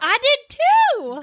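high, slow, loud proud squeal. Real, not acted. Bragging to her friend about the pull-ups, squealing.

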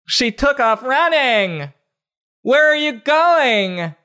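very high, drawn-out hollered reading; mock shouting. Reading the girl's quote aloud, absurdly high mock shout. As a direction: holler every word slowly, way up high. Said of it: "I shouted".

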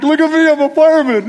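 high, loud playful boast; gleeful mock fireman boast; excited shout. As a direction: crow proudly to the kids like a child at play. Showing off gear to kids.

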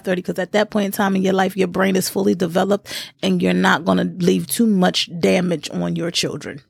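ordinary talk

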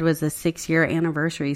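ordinary delivery

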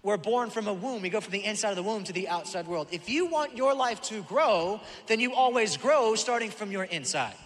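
fired-up pulpit delivery; preaching. Acted. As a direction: preach it out to the whole room. Opening of the sermon.

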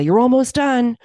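helpful encouragement. Retold or imitated. Spectator to a runner on an unknown course, encouragingly. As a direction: call it out to lift a runner. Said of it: "very helpful for me to hear".

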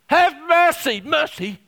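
loud begging cry, high; pleading cry. Acted. Blind man's cry that stops Jesus, loud pleading. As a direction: cry it out, then let it fall. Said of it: "it's the cry".

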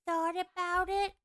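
inhaled ditzy-blonde voice; playful alter ego, unlike your own voice; put-on character voice. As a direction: play a dumb blonde, air sucked in. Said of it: "A dumb ditzy blonde".

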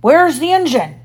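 loud, high demand. A narrator's reading. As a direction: demand an answer as a pirate captain. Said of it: "he demanded".

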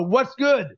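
WHAT'S GOOD lifted high, then falling; positive inflection. Shown as a demonstration. Podcast host demonstrates his new greeting, positive inflection on "What's good?". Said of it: "a positive inflection".

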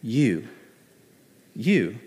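"You." set apart by long pauses; stress on you. Shown as a demonstration. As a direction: land "You." alone, then again. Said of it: "It's this word".

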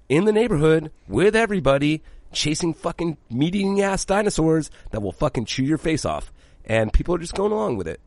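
animated, incredulous rant; excited rant. Real, not acted. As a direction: rant it like it's outrageous. Ranting about the show's absurdity.

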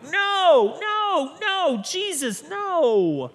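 loud, high pleading no; shouted no, no, Jesus, no; Peter's loud protest. Acted. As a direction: cry out no to stop him.

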